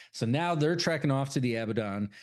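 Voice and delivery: normal talking voice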